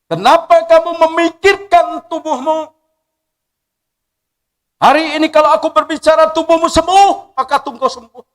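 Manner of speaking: booming high voice of the Lord; voice of God. Acted. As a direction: proclaim it as God rebuking him. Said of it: "Dia berkata kepada saya".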